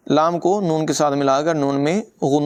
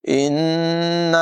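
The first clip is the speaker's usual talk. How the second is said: slow, higher melodic tajweed chant; Quran recitation; 7 semitones higher, much slower than usual. Shown as a demonstration. Teacher recites the verse for students, slow melodic chant. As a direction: chant each word long and flowing.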